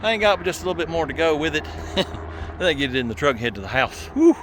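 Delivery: quick, quiet words between gasps after hauling the wheelbarrow; softly panted, hurried and breathless; out of breath. Real, not acted. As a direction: talk while winded from pushing a heavy load. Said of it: "I'm out of breath".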